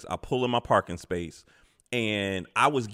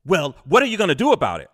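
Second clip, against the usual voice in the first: louder, faster demanding voice; demanding lady; 5 semitones higher, 7 dB louder, faster than usual. Retold or imitated. Older lady demanding he fix her scratched car. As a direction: demand it like you're owed. Angry lady confronting narrator.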